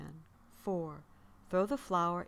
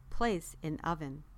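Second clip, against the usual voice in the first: usual speaking voice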